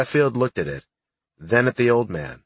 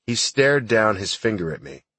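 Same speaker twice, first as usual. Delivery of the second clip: plain reading voice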